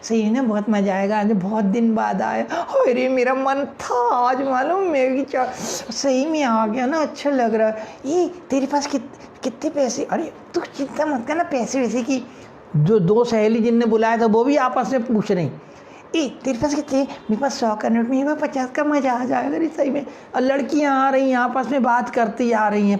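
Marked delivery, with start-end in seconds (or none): 0.0-12.2 put-on lightly raised girlish chatter; a hint of giggly girl voice; girls chatting. 16.2-20.1 put-on higher girlish voices; gossiping schoolgirl tone; excited friend counting money; girls chatting.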